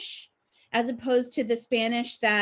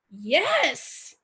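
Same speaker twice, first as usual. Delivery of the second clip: proud delight; 3 semitones higher than usual. Retold or imitated. Learner recalling her thought on being told she sounds Mexican, proud delight. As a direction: relive a private little moment of triumph. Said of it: "I was really proud".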